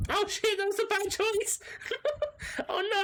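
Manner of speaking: loud, very high panicked laughing cry; high panicked yelp. Real, not acted. Player panics over a bad game choice, high loud squeal. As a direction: squeal in horror at the mistake.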